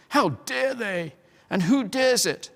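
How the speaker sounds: high, slow outraged demand; indignant king. A narrator's reading. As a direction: challenge the culprits. King draws his sword in anger.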